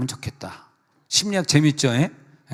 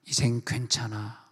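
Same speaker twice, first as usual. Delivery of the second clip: soft reassurance; 3 semitones lower, 7 dB quieter, slower than usual. Pretend. Voicing self-comfort in prayer.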